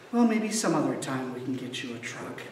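put-on calm the child down gently; gentle and kind. Mother gently putting off her son's truck request, soft and patient. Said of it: "in a very gentle and kind manner".

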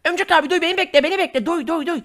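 put-on very high, frantic kid voice; little boy panicking at the alien; panicked little kid. Kid sidekick voice.

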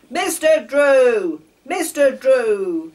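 loud, slow calling out; slow loud shout of the name; sharp shout. A narrator's reading. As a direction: call him sharply across the platform. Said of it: "shouted the station master sharply".

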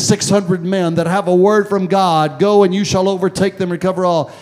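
loud, high, driving sermon delivery; full-force preaching. Acted. Preacher rousing the church, loud and high.

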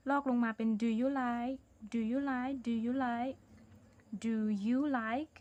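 very slow, word by word; slow dictation. Shown as a demonstration. Teacher copying the question slowly for pupils, slow dictation. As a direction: spell out do you like slowly for the pupils. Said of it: "ประโยคเราลอกเลยค่ะ".

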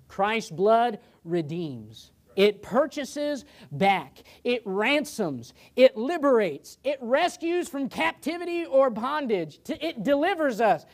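slow then rising, high preacher's cry; preaching at full force. Acted. Pastor preaching redemption to the congregation, slow and rising. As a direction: build it slow and lift it high.

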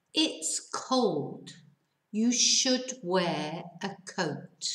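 "It's cold. You should wear a coat" read slowly, word by word. Shown as a demonstration. Teacher reads the example slowly to students. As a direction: read it slowly for learners to follow. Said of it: "voy a leerlo, lento".

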